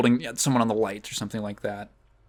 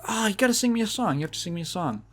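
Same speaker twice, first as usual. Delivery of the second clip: eager pleading; 7 semitones higher, faster than usual. Retold or imitated. Jesse begging Celine to sing him a song, eager and fast. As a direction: coax her like an excited kid.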